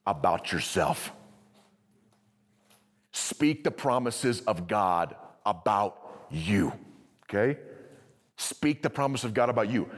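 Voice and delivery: ordinary talk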